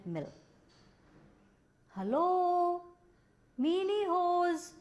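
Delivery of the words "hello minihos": high, slow calling into the dark. Acted. Jobiho calling the creatures in the dark mill, high and slow. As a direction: call out as if searching a big room. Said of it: "he called out".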